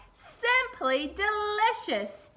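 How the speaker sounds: high, loud, very slow delighted yell; happy yell. A narrator's reading. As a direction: shout it out with pure joy. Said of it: "he yelled".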